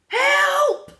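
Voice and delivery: high, loud panicked cry; scream for help. A narrator's reading. Friend pushed into the pool screaming for help. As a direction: shriek for help as she sinks. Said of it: "she screamed".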